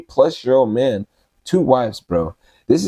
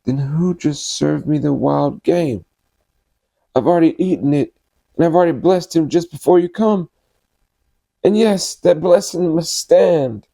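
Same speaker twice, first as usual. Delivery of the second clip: trembling; 7 semitones higher than usual. Acted. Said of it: "began to tremble uncontrollably".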